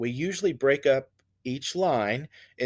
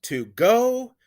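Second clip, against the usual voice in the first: "To go" with GO high, loud and drawn out; light To, punch go upward; iambic stress; 9 semitones higher, 6 dB louder than usual. Shown as a demonstration. Teacher showing the next iamb, strong stress on go. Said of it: "unstressed syllable first, and then the stressed one".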